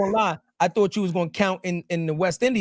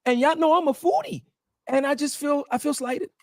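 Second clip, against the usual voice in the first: higher-pitched, put-on jealous complaint; mock hurt; 7 semitones higher than usual. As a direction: play slighted, with pauses between the grievances. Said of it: "I'm a little bit sad".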